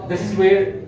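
ordinary delivery

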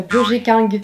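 Burger King as French "bur-jé king"; French accent. Shown as a demonstration. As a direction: read the brand name with French sounds. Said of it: "avec un accent bien français".

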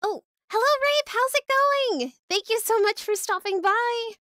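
high-pitched, loud sing-out welcome; bubbly hostess squeal; excited greeting. Real, not acted. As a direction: gush a thankful hello. Greeting a newcomer in chat.

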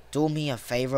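low, soft muttered request; quiet plea. Real, not acted. Player pleading with the game to himself, quiet plea.

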